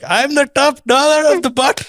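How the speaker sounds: high-pitched mock Indian accent. Comic recalling his friend's drive-through bit, mock Indian accent. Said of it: "the accent comes out Indian".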